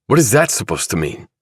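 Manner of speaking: sharp defensive bark. Acted. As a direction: snap back at the accusation. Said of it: "barked Chilton".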